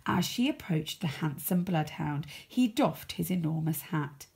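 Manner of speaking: usual speaking voice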